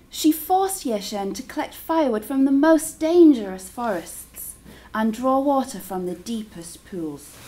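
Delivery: ordinary delivery, nothing marked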